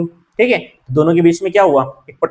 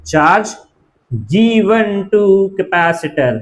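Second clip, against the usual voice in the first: very slow, stretched-out words; drawn-out teacher dictation; dictating; much slower than usual. Real, not acted. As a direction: dictate slowly for students writing it down.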